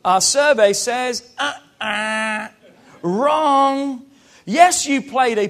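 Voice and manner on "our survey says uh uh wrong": mock buzzer verdict; a hint of game show host. Pastor playing game host rejecting the objection, mock verdict. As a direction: pronounce it like a quiz host. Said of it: "game show buzzer".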